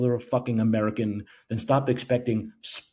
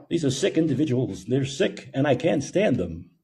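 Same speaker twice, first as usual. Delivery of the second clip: ordinary delivery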